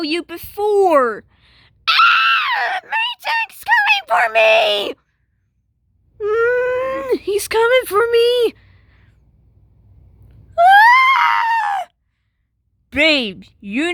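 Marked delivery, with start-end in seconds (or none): 1.9-4.9 shrieking much higher and louder in panic; terrified scream. Acted. Girl screams fleeing the boss. 6.2-8.5 terrified scream. Acted. Girl screams fleeing the boss. 10.6-11.9 piercing shriek, much higher and louder; terrified high scream. Acted. Girl screams fleeing the boss.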